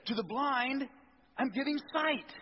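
high, loud proclamation; proclaiming. Acted. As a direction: announce it with joy. Proclaiming Jesus' words.

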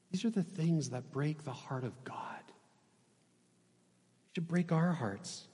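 soft, half-whispered plea; hushed gravity. Real, not acted. Preacher on what moves him to tears, hushed. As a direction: say it softly, with long pauses. Grave reflection on God's broken heart.

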